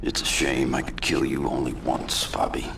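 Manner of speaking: low, soft, menacing whisper over the casket; cold whisper. Acted. Punisher whispering to dead Bobby, cold and soft. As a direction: breathe it like a private threat.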